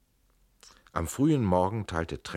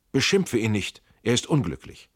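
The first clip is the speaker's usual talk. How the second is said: sharp rebuke; 4 semitones higher, 7 dB louder than usual. Acted. Trenck defends his cousin.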